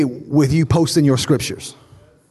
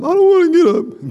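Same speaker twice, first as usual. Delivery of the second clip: put-on whiny child; 10 semitones higher than usual. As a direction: whine it like a tired kid. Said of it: "son refusing to get up".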